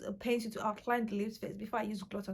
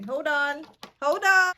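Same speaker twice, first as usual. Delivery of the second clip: excited call; 4 semitones higher, 11 dB louder, slower than usual. Real, not acted. Hurrying off to do lashes.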